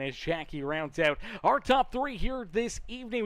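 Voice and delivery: loud, high race announcer call; announcer hype. Acted. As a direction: pump up the crowd like an MC. Announcer hypes finishing driver.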